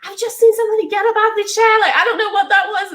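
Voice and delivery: high, rushed retelling of a fright; scared. Retold or imitated. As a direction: blurt it out as if just spooked. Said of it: "it scared me so bad".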